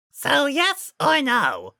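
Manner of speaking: slow, high silly goon; dim henchman voice. A narrator's reading. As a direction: ask slowly and dimly. Henchman presses.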